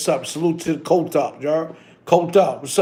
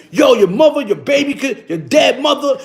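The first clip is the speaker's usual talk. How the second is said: mock tirade, high and loud; imitated wilding out; 7 semitones higher, 6 dB louder than usual. Imitating a friend going off on commenters, mock wild rant. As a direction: reel off insults like someone going off. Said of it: "he wilding on these".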